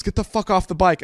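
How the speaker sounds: stern cop order. Retold or imitated.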